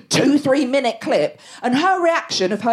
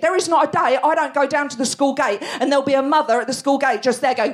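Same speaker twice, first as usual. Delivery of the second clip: ordinary talk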